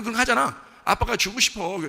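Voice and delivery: usual speaking voice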